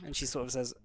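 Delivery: ordinary talk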